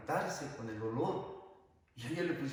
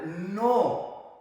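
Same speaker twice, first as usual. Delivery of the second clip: a loud, higher, emphatic no; firm contradiction; 4 semitones higher, 8 dB louder than usual. Retold or imitated. Priest correcting a mother who blames her husband, firm. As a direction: cut in with a forceful denial.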